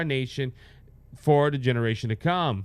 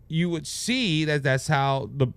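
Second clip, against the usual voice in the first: ordinary delivery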